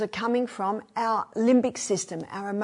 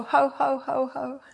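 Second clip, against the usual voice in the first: deliberate hearty ho ho laugh; forced laugh; 5 semitones higher than usual. Shown as a demonstration. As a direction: show how to laugh on purpose. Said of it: "Just, just go".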